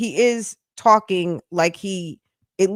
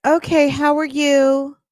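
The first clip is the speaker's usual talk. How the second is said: higher-pitched mocking imitation of her greeting; mock baby talk; 6 semitones higher than usual. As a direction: mimic her in a high little voice. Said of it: "I'm just gonna talk baby talk".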